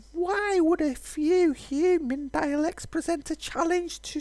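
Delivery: high, slow, grandiose speech; old dragon scoffing at the hero's surprise; haughty dragon. Acted. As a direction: boast like an ancient, vain dragon. Voicing the dragon character.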